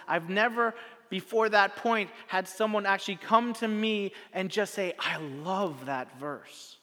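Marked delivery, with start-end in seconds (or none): 5.0-6.8 very slow, softly lingering; slow wonder. Retold or imitated. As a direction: savor each word like he did. Friend's line quoted again slowly.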